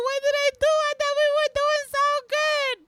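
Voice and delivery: high, pleading mock-hurt voice; whiny stalker voice. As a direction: play the clingy ex begging why. Acting out a needy stalker.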